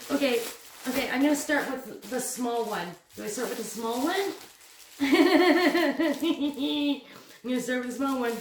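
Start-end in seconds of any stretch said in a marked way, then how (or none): 5.0-7.0 long, rippling giggle; excited giggle. Real, not acted. Vlogger picks the small one, excited giggle.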